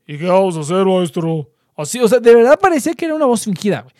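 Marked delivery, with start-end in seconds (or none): 0.1-1.5 deep-voiced classmate. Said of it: "su voz ya es como de".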